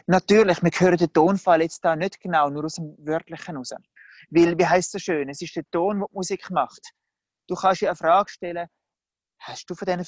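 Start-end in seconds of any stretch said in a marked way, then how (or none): none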